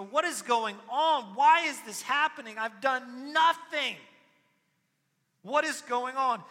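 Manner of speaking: high, loud, anguished pleading; pleading desperately. Acted. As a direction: Job pleading his case before God. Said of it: "pleads his case".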